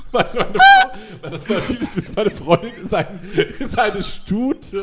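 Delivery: laughing through the words; can't stop laughing. Real, not acted. Cracks up mid-story.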